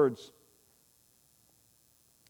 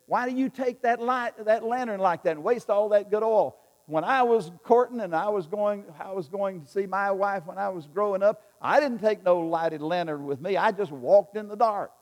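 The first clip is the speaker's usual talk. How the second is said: loud, higher scolding of a wasteful hand; scolding farmer; 4 semitones higher, 5 dB louder than usual. Retold or imitated. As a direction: chew him out like a stingy old farmer. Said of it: "the farmer chewed him out".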